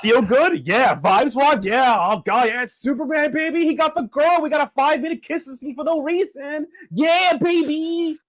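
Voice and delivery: hyped up. Real, not acted. Hyped about Superman movie vibes.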